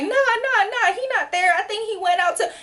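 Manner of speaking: high, rushed, nervous lying; frantic denial. Retold or imitated. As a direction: cover for him in a panic, pitched high. Friend lying at the door.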